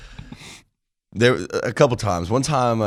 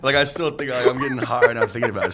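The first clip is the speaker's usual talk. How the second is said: laughing through it; 5 semitones higher, 8 dB louder than usual. Real, not acted. Laughing over the memory.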